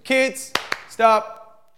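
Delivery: calling out to stop. Shown as a demonstration. Mum calls the kids away from play, loud firm call. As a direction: call them to stop, firmly and loudly. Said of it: "to stop what you're doing".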